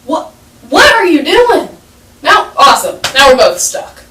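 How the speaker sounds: high, loud exasperated outburst; acted-out exasperation, sarcastic and loud; frustrated. Stuck boy to the teacher who jumped in, frustrated. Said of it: "He was so frustrated".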